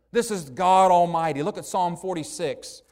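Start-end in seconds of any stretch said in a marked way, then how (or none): none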